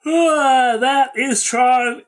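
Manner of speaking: high, loud, long-drawn mock fanfare; grand title announcement. Host wraps up the film recap, loud drawn-out announcement. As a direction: belt out the title slowly, stretching the last word.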